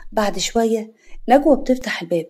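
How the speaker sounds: ordinary delivery, nothing marked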